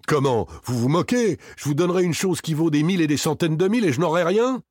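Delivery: indignant outburst, pitched well up; outraged disbelief. A narrator's reading. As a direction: protest in rising outrage. Victor outraged at offer of nothing.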